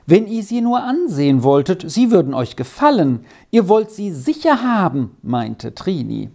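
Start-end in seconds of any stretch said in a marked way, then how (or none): 0.1-5.2 put-on pleading girl. A narrator's reading. Girl pleading with farmer's wife.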